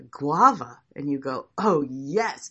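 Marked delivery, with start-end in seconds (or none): 1.6-2.5 mock delight. Acting delight at being handed guava.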